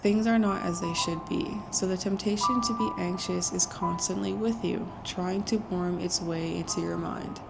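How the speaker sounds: hushed voice, soft so nobody wakes; whispering. Real, not acted. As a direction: keep it down, someone's asleep next door. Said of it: "I am gonna whisper because Jason's literally sleeping".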